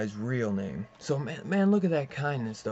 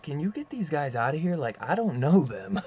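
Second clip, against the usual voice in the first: retold appeal, rising higher and louder; imitated plea, building to a louder protest; mock urgent plea; 4 semitones higher, 4 dB louder than usual. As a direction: lightly act out wanting rid of strangers. Acted plea to get men out.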